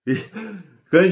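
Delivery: laughing. Real, not acted. Laughing while telling the story.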